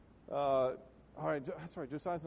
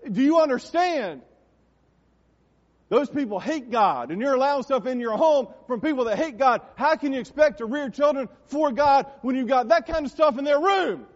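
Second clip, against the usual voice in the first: full-force pulpit shout, pitched high; dad's fiery preaching; 8 semitones higher, 11 dB louder than usual. Retold or imitated. Retelling dad's sermon against godless entertainment at home, shouted preaching. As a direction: imitate dad thundering at parents, building to the end. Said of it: "That's how he said it".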